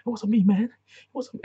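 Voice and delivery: high, loud frantic pleading; hammed-up hysterical insisting it wasn't her; mock frantic denial. Singer denying everything mid-breakdown, mock frantic high pleading. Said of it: "she has a breakdown".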